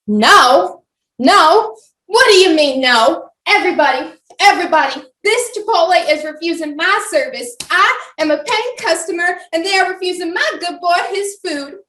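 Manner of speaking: loud, high public outburst; cause a scene in the restaurant; outraged Karen. Acted.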